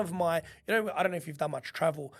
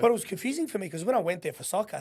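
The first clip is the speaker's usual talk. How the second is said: normal talking voice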